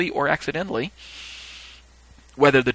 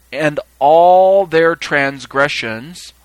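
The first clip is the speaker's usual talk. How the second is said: "all" in "all their transgressions" long and loud; slow, forceful stress on "all their transgressions"; emphatic reading; 3 semitones higher, 7 dB louder, much slower than usual. Shown as a demonstration. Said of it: "I'll do the same thing".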